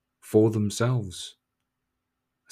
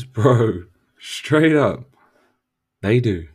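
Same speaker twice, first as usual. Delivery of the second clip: loud emphatic; 8 dB louder, much slower than usual. Acted. Emphatic retort.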